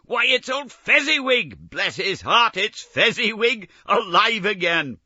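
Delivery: very high, loud, delighted cry; overjoyed to see him; great excitement. Acted. Scrooge to the ghost, crying out in great excitement. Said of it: "cried in great excitement".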